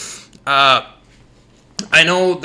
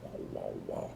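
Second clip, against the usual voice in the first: low unvoiced wah wah; faint whispered drone; 20 dB quieter, much faster than usual. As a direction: breathe out the garbled voice. Customer's garbled voice again.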